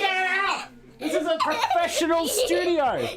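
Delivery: high, laughing mock protest. Real, not acted. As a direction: laugh it out in pretend outrage. Dad laughing at daughter's cheek.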